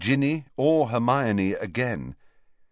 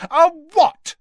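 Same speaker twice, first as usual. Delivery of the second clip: very high, loud angry demand; shouted challenge; 10 dB louder than usual. Acted. Ginny drawing her wand on her brother, shouting. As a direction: throw the question back at a shout. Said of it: "shouted Ginny".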